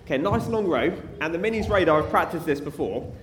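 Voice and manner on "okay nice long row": normal talking voice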